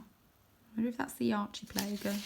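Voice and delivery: plain reading voice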